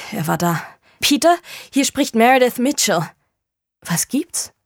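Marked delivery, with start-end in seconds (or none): 1.0-3.1 urgent phone call. Acted. 3.8-4.5 surprised. Acted. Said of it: "fragte er überrascht".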